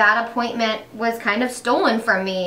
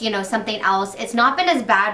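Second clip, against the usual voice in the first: ordinary talk, nothing marked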